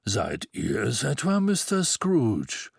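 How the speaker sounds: slow, deep, resonant voice; Mister Xmas greeting an old acquaintance, deep and full. A narrator's reading. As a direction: let the question ring out full. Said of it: "viel tiefer und volltönender".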